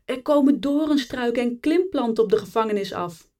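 high, urgent shout of alarm; called-out alarm. A narrator's reading. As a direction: call the warning out to the others. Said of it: "riep er een".

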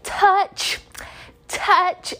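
put-on high teasing singsong of a bratty kid; mocking taunt. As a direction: taunt her back like a brat. Classmate mocking the warning.

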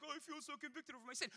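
quick, high put-on whine; mock moping. Preacher imitating people moping around in church, mocking whine. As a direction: mimic a self-pitying churchgoer. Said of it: "stop moping around in the church".

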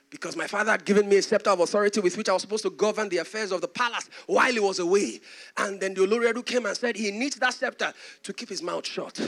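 usual speaking voice

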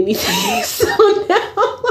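high breaking laugh through the words; can't hold the giggle in; laughing fit starting. Real, not acted. Bursting into laughter mid-story.

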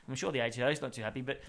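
usual speaking voice

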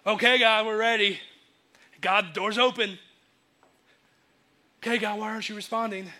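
acted impatience with God; frustrated. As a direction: call up to God, waiting between appeals. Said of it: "been frustrated with God".

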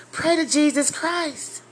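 high, urgent exhortation; fervent exhortation. Real, not acted. Preacher urging the congregation, high and urgent. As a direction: cry it out to the crowd.

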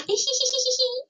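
very high-pitched put-on tee-hee; squeaky imitation of a girlish giggle in a steady run; mock giggle. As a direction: do a silly falsetto giggle. Imitates character's giggle when picked up.

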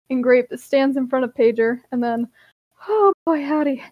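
ordinary delivery, nothing marked

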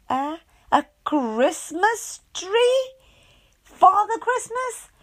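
high, slow astonished questions; baffled. A narrator's reading. Miss Wise questioning her host, baffled. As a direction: disbelief at every word.

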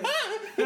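very high, short yelp; sudden high comic "ah"; mock tickled shriek. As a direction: imitate a ticklish squeal. Acts out reaction to being tickled.

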